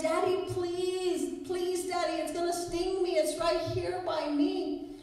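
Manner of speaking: high, panicky imitation of a child; pleading little boy. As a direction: beg Daddy for help in a small boy's high voice. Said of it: "the little boy starts screaming".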